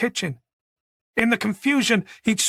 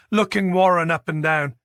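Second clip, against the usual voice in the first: ordinary delivery, nothing marked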